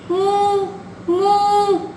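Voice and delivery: playful cow imitation; cow moo. Shown as a demonstration. Teacher imitates cow sound.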